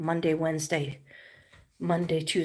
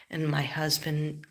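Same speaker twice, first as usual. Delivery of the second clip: ordinary delivery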